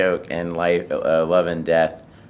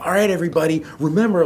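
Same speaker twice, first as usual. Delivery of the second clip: much higher, louder announcer voice; Disneyland caution voice; 13 semitones higher, 6 dB louder, faster than usual. Shown as a demonstration. The voice greeting guests at Disneyland, high and loud. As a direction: boom the park safety announcement. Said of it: "my voice being like".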